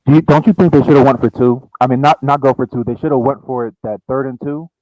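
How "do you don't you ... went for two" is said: ordinary delivery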